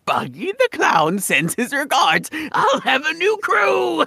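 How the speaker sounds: lightly voiced schemer, laughing into a shout; scheming Buggy voice. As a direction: build from a sly mutter to a gleeful yell. Voicing Buggy, ends in shout.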